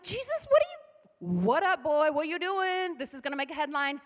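high, teasing buddy voice challenging Jesus; disciples mocking Jesus. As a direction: play the nosy disciples, incredulous.

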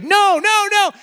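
high, loud, anguished no; injured French lady's wail. Retold or imitated. Preacher voicing the bleeding French lady's reply to the flight attendants, high and anguished. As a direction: wail it like the hurt old woman. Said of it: "she's like".

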